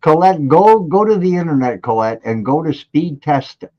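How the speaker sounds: high, loud instruction; calling out. Real, not acted. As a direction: urge her on from a distance. Calls out instructions to Colette.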